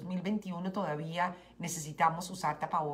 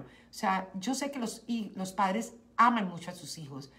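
ordinary talk, nothing marked

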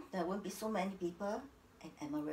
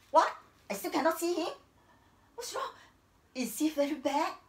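alarmed wife; 6 semitones higher, 4 dB louder than usual. Acted. Wife refused, alarmed questions.

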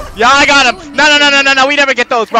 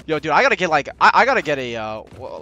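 normal talking voice

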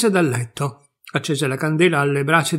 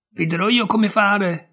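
put-on high, loud call from a tiny boy; loud eager offer; shouting; 7 semitones higher, 4 dB louder than usual. A narrator's reading. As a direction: shout it out boldly. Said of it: "gridò molto forte Pollicino".

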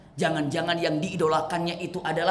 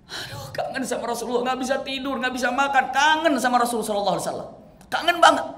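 high, rising tearful wail, pushing faster; crying with longing; 8 semitones higher, 4 dB louder than usual. Acted. Preacher voicing Thauban weeping for the Prophet, high tearful wail. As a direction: weep out how badly you miss him.

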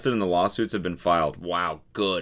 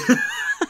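high laugh; 4 dB louder than usual. Real, not acted. Laughing mid-imitation.

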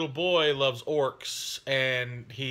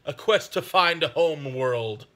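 mock epic narration; 3 semitones lower, slower than usual. Dramatic narrative intro, "dramatic music.

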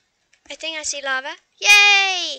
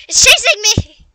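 panicked; 6 semitones higher, 10 dB louder than usual. Real, not acted. Fleeing a dragon in game.

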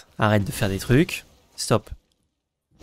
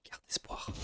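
breathy whisper; whispered encouragement; 22 dB quieter than usual. Acted. Player whispers hope to teammate Val. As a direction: whisper it like a secret.

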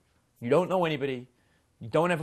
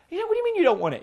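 much higher, louder incredulous squeak; shocked disbelief; 6 dB louder, much faster than usual. Retold or imitated. Shop clerk stunned the customer refuses the washing machine, shocked squeak. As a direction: sound floored that she won't buy it.

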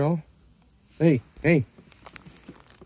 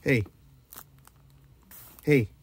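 normal talking voice

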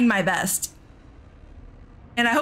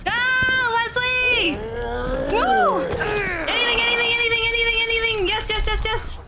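panicked yelling; 10 semitones higher than usual. Real, not acted. Panicked yelling during a firefight.

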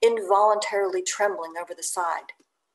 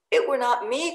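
louder, higher protest of innocence; cried; 5 semitones higher, 4 dB louder than usual. Acted. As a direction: plead your innocence quickly. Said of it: "cried Doughboy".

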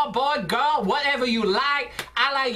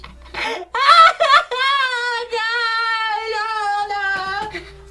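long, loud high-pitched fake howl; hammed-up drawn-out cry of pain; mock agony wail; faked wail; 8 semitones higher, 6 dB louder than usual. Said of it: "I fake it".

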